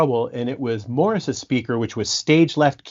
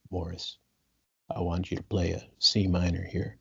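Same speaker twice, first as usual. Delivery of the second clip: much softer and lower, nearly murmured; 7 semitones lower, 10 dB quieter than usual. Retold or imitated. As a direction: imitate a star speaking barely above a breath. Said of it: "'cause [name] talks quietly".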